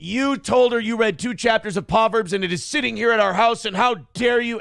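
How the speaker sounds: hammed-up accusation, building to how dare you; mock outrage. Roommate berating Aaron about the book, mock indignant. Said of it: "Everyone went fucking crazy".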